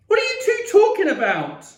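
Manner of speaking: very high, loud demand; shouted. A narrator's reading. Boy shut out of family talk, shouting. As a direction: shout the question at both of them. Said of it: "I shouted".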